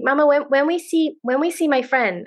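a hint of a small girl asking her mom; little daughter's voice. Daughter quoted climbing into seat.

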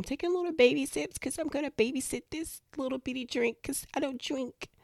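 high, soft little voice; dainty babysitting voice. Retold or imitated. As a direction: act out tiny sips in a small, high voice. Acts out reluctant tiny sips.

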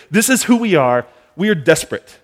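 high, loud, pleading crowd; desperate plea. Retold or imitated. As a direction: plead with him at full voice. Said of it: "They let themselves be true and say".

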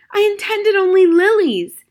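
high, loud desperate shout; shouted plea. Acted. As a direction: cry out to be believed. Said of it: "shouted the magician".

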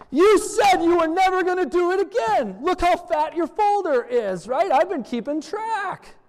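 high, exasperated mock rant; fed-up mock scolding. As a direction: play the fed-up friend who finally snaps. Exasperated pastor acting fed up.

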